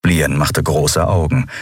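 ordinary delivery, nothing marked

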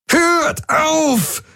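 very high, strained desperate yell; roaring. A narrator's reading. Jun screams at everyone to stop, very high and desperate. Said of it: "brüllte Jun".

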